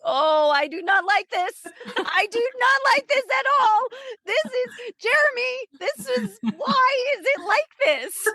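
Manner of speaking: retold crying protest to her husband Jeremy; distressed wailing. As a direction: wail it like a contraction just hit. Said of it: "I'd be like".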